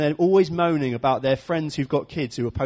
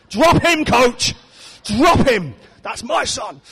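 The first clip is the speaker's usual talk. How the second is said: loud, high sideline bellow; outraged parent shout; 8 semitones higher, 7 dB louder, much slower than usual. Acted. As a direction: yell at the coach in hammed-up indignation.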